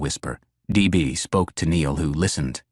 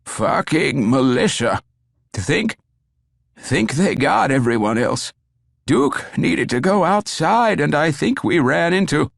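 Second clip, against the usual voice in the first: strained, high, gasping dying man; 9 semitones higher, 3 dB louder than usual. A narrator's reading. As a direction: force the words out through pain.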